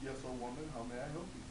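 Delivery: low gentle magic fish. Acted. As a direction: answer her calmly from the water. Fish answers.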